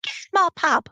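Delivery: high brassy landlady shout; lightly voiced Cockney landlady; Barbara Windsor. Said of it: "Barbara Windsor".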